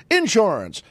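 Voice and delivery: "insurance" with the accent on IN; stress on first syllable. Shown as a demonstration. Showing how Southerners say it, "insurance" stressed up front. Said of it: "putting the accent on the first syllables".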